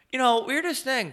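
very high-pitched imitation of small talk; chatty opener. Acting out chatting up barista.

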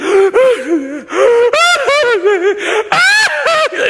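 a high, loud gasping sob, half laugh; imitated ugly cry. Preacher acting out his ugly cry, a loud gasping sob. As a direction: gasp and sob like you're breaking down. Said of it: "turned into, like, ugly cry".

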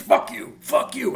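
loud, spat-out curses; retold shouting back. The actor shouting back across the table, angry. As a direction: throw it back at him.

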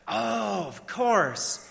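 high, stretched "oh" settling back down; feigned recognition. Retold or imitated. Preacher answers former camper he can't place, feigned recognition. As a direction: pretend you remember, with a rising-then-easing voice. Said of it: "And I said".

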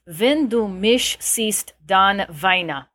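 slow, deliberate German reading of "Wenn du mich siehst, dann weine"; German: langsam und sorgfältig gelesen; careful German. Shown as a demonstration. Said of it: "I'm going to attempt this".

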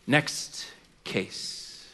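low, very soft dismissal with a pause between words; dismissive judge. Acted. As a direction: wave her away quietly, as the judge. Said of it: "that judge would look right past her".

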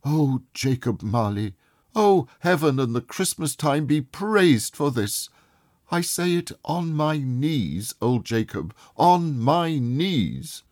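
high, joyful vow of thanks; overjoyed old man giving thanks; fervent joy. A narrator's reading. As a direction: pour out thanks, almost praying.

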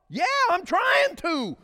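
high, loud flustered answer; flustered reply. Retold or imitated. Husband answering his wife while fumbling, loud flustered reply. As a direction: hurry to placate her.

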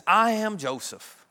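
dramatic reveal. Acted. Joseph reveals himself to his brothers, dramatic reveal. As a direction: announce it slowly, every word held.

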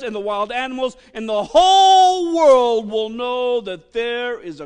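loud, slow proclamation; fiery preaching. Acted. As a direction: thunder it from the pulpit. Preaching the battle at full force.

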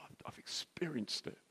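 hushed, breathy confession; barely voiced, sincere admission; whispered. Real, not acted. Whispering to the weeping man that he has lived it.

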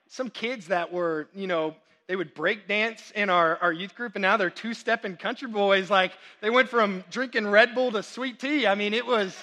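ordinary talk

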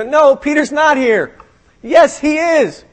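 high-pitched acted squabble; hammed-up excited contradiction; mock argument. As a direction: act out an insistent back-and-forth. Acted back-and-forth argument over Peter.

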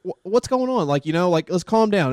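hey-dude calming plea; calming a patient. Retold or imitated. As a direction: try to settle a screaming patient. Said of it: "I'm like".

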